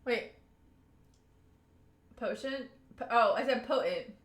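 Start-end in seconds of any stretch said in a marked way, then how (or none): none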